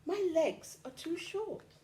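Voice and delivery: high little bird voice; chirpy bird. A narrator's reading. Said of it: "chirped the bird".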